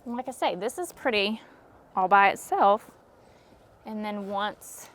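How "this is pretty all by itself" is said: ordinary talk, nothing marked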